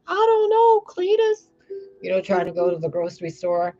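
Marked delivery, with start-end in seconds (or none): 0.1-1.5 put-on much higher quavering old folks' voice; old couple. Old neighbours fretting over their money, high old voices. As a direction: play a fretful elderly couple, high. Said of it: "they'd be like".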